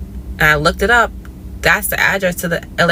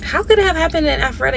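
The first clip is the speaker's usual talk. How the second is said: fast, higher disbelieving question; incredulous question; 9 semitones higher, much faster than usual. Retold or imitated. Questioning the fake police caller, fast and incredulous. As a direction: rattle it off, baffled.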